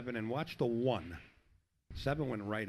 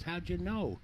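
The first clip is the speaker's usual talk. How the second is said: higher, sheepish voice; meek surprised; 6 semitones higher, slower than usual. Retold or imitated. Caught-out Polish guy answering the counterman in a meek higher voice. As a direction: let the Guido act collapse into meek surprise. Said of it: "changes his attitude and his voice".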